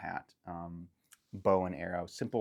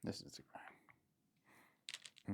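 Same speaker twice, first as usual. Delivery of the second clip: whisper; 16 dB quieter, much slower than usual. Acted. GM whispers a reveal slowly.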